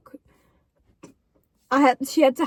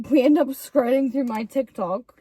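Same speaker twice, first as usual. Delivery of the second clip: usual speaking voice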